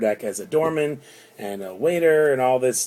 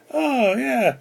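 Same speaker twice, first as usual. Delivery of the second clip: mock enthusiasm; 13 semitones higher than usual. Mock-enthusiastic punchline reply.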